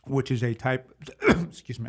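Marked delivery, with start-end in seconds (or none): 1.2-1.9 throat-clearing, slightly strained. Real, not acted. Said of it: "I'm not choking".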